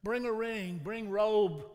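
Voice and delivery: loud, high joyful shouts of command; shouting orders. A narrator's reading. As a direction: call out the orders as he runs to his sons. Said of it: "shouting orders".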